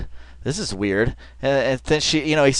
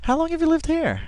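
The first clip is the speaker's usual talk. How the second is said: mimicking her earnest curiosity, higher; sincere question; 4 dB quieter than usual. Host replays the investigator's earnest question, higher and sincere. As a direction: ask it with genuine interest, pitched up. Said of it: "It's really sincere".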